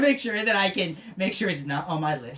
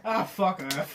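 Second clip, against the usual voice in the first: normal talking voice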